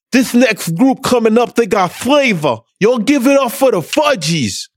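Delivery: put-on high, loud old-school MC hyping the crowd. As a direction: announce the group like a club host from back in the day. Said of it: "But he talked like this".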